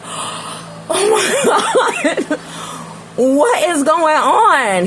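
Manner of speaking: gasp, then high, slow, half-laughing panic; frightened gasp. Retold or imitated. After the boyfriend pulls her back, panicked. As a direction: gasp and cry it out in disbelief. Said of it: "I was like".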